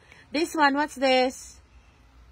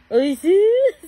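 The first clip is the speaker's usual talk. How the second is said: excited praise; 8 semitones higher, 7 dB louder than usual. Real, not acted. Praising toddler.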